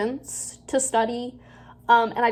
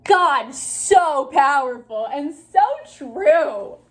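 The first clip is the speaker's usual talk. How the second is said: sarcastic; 3 semitones higher, 5 dB louder, much slower than usual. Real, not acted. Sarcastic praise of herself.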